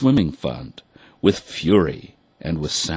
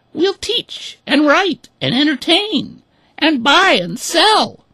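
very high, louder hen voice; eager hens; 6 dB louder than usual. A narrator's reading. Hens telling ducks how they will earn bread, squeaky. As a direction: list your skills keenly as a hen. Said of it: "they said".

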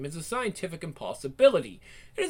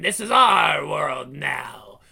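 slow, loud monstrous roar; booming villain; 4 semitones lower, 6 dB louder, much slower than usual. Acted. Demon boss roaring at the hero, slow and loud. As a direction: boom it out like a demon.

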